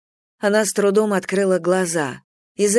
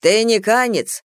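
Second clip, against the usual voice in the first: slow, louder, higher call; shouted question; 4 semitones higher, 5 dB louder than usual. A narrator's reading. Prisoner questioning the guard behind, calling out. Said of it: "закричал Бадзы стражнику".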